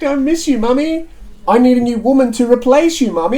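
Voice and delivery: put-on high, whiny mama's-boy voice; whiny baby voice. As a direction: whine it like a big baby. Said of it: "Sookie extra whiny baby voice".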